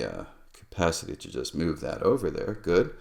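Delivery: normal talking voice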